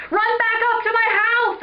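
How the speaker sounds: high, loud urgent shout. Acted. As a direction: yell it out as an order to flee. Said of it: "Eric shouted".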